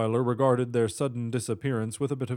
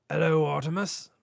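higher-pitched wizard's greeting; raised, unexpected hello; Merlin; 5 semitones higher than usual. A narrator's reading. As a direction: greet him in an old wizard's voice. Merlin greets in acted dialogue.

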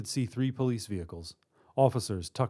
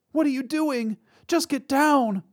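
very high, loud, desperate; frightened cry; 6 dB louder than usual. A narrator's reading. Mother with child to fellow hostage, panicked cry. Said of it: "the mother cried".

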